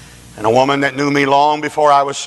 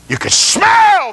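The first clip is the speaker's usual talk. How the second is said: preacher's shout; 6 dB louder, slower than usual. Acted. Preacher's vivid climax.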